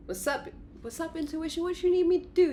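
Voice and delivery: high, eager voice; high ego voice. Acted. Ego greeting intuition, high eager voice. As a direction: chirp it like an eager helper. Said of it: "your ego with, like".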